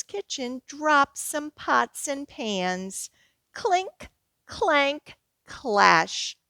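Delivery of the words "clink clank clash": clanging sounds. Acted. Imitating pots crashing.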